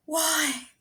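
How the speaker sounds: a breathless last plea; lightly voiced dying princess; dying cry. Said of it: "the girl cried with her dying breath".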